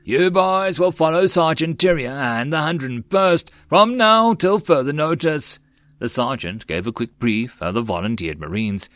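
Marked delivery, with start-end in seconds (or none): 0.0-5.6 high, projected military briefing; sergeant's orders. A narrator's reading. Sergeant briefing volunteered Marines, projecting orders. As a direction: issue orders to the whole squad.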